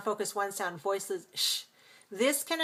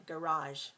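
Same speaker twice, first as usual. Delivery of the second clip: garage as guh-RAHZH, lower and softer; second-syllable stress; 4 semitones lower, 5 dB quieter than usual. Shown as a demonstration. Teacher repeating American stress pattern, garage as guh-RAHZH. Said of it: "two syllables stress the second".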